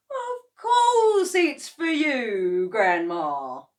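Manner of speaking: very slow, sugary, high coaxing; sweetly. A narrator's reading. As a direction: sweet-talk her into drinking. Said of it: "he smiled sweetly".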